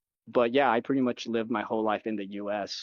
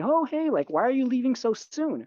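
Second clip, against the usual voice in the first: much higher put-on neighbor voice; high, mock-curious neighbor; imitated neighbor; 12 semitones higher than usual. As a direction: imitate a nosy neighbor's high-pitched question. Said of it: "the next door neighbor, she asked me".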